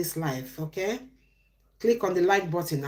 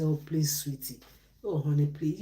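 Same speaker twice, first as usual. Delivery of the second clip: soft, lower, coaxing plea to an angry partner; pleading softly; 4 semitones lower, 3 dB quieter than usual. Retold or imitated. Partner soothing an angry spouse with pet names, soft pleading. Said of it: "As if I say".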